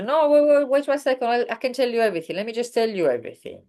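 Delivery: eager no wait, let me tell you everything; urgent. Shown as a demonstration. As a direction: stop them and rush to confess. Urgent new context, stopping someone.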